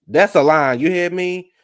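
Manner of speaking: loud excited praise for a great line; excited hype. Real, not acted. Excited reaction to quote.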